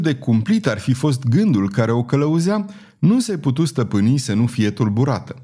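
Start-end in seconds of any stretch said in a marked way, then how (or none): none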